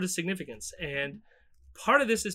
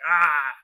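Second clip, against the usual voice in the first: angry yell; 11 dB louder than usual. Retold or imitated. Mimicking Trump's angry face in picture.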